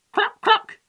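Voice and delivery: high, loud clucks; chicken clucking. A narrator's reading. Chickens chase the gingerbread man, clucking. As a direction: cluck like a hen. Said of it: "they said".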